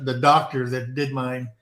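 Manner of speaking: usual speaking voice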